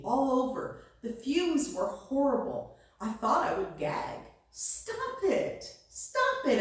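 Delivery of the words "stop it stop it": very high-pitched plea, acted; crying out. A narrator's reading. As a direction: squeal it in storybook protest, building on the repeat. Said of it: "I cried".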